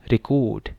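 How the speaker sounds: Record with the stress moved to the end, re-CORD; stress on second syllable. Shown as a demonstration. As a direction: say record as the verb, last syllable punched. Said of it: "record दूसरे पे चला गया". (Record दूसरे हिस्से पर ज़ोर)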